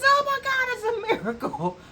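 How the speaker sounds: high, loud gasp of wonder breaking into a laugh; amazed exclamation. Retold or imitated. Elderly client marveling that she can wiggle her toes, high amazed and laughing. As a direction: shout it like you've just seen a miracle.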